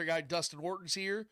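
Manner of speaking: ordinary talk, nothing marked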